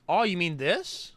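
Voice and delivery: ordinary talk, nothing marked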